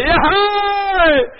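high, loud drawn-out whoop; long whooping yell. Acted. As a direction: whoop it out at full volume. Jubilant cry, then scream.

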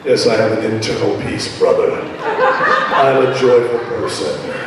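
mock a stiff, lifeless believer; low, flat-toned mock piety; stoic pious brother. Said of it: "reduce your concept of joy down to stoic peace".

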